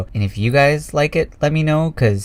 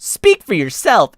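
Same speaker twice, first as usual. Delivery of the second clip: grinning retort; 8 semitones higher, 4 dB louder, slower than usual. A narrator's reading. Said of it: "Echo grinned".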